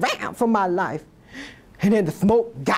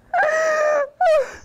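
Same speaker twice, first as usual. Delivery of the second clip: mock anguished wail. Mock grief.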